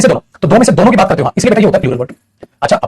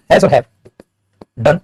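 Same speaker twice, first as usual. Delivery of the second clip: ordinary talk, nothing marked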